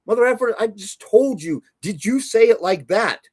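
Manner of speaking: fed up, almost shouting at the client; exasperated outburst. Real, not acted. Ranting at clients who won't listen, exasperated high outburst.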